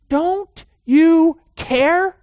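high, loud, slow drawn-out plea; accusatory cry. Retold or imitated. Disciples shouting at sleeping Jesus, slow loud accusing plea. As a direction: stretch out "Don't you care" high and loud, accusing. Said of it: "they have this accusatory tone".

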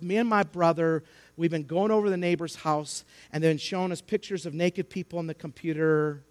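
ordinary talk

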